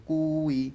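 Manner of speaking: "কুই" with the "কু" held long; teacher's elongated "কুই"; drawn-out vowel. Shown as a demonstration. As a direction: stretch the u, then add the i in "কুই". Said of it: "এগুলো টান দিয়ে পড়তে হবে".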